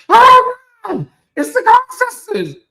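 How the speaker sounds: very high, loud mock shriek of delight; shrieking over excited. Imitating [name] shrieking as the [name] sisters arrive, very high and loud. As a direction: squeal it like an overexcited host. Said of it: "and he's like".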